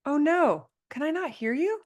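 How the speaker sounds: sudden high alarmed realization; oh no moment, startled; surprised. Real, not acted. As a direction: caught off guard, pitch jumping up. Realizes she muted the call.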